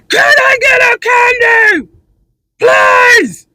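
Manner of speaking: put-on high shrill toddler scream, slow and loud. Toddler screaming for candy at mom. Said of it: "or they screaming".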